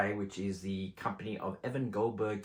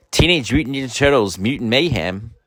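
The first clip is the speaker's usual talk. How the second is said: loud promo announcer read of the title; 3 semitones higher, 16 dB louder than usual. Acted. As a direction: boom out the title like a trailer. As the lovely intro said.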